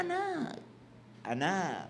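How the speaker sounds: lightly voiced sweet mother, slow and soft; gentle mother. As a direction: coo "Anak" sweetly like a tender mom. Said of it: "Gentle na gentle".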